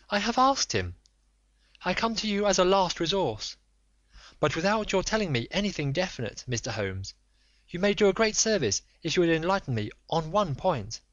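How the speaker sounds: high, urgent, imploring lady; a proud wife begging for the truth; pleading noblewoman. Acted.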